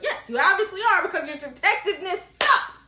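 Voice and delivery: hammed-up scolding that peaks on "sucks"; exasperated. Exasperated repeat of the insult.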